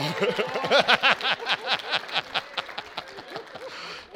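long, rolling burst of laughter; delighted laugh. Real, not acted. Pastor delighted his wife made it, long laugh. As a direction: laugh freely as it works out.

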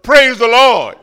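loud imitated praise. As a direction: praise the Lord thrown out loud and high. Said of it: "She couldn't get enough of it".

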